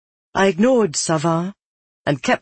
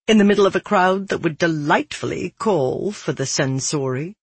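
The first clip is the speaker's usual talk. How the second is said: plain reading voice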